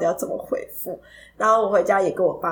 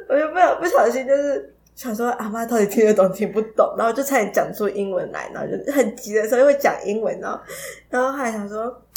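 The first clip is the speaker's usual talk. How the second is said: ordinary talk, nothing marked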